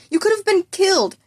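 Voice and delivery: a teacher at the end of his patience; desperate outburst. A narrator's reading. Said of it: "growing desperate".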